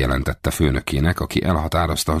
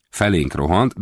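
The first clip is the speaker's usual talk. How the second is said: usual speaking voice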